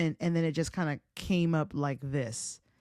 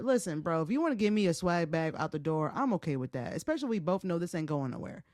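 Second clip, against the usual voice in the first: normal talking voice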